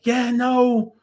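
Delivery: frantic bro, stop it; panicked pleading. Retold or imitated. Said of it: "Wanda is like".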